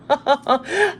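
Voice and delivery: short, high, rising laugh; a quick burst of delighted laughter. Real, not acted. Reader laughs at her own words on birthing hope, quick high laugh.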